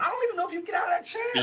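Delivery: high, fast, shouted taunt; mocking taunt. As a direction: yell it out as a jeering taunt. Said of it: "Wait a minute".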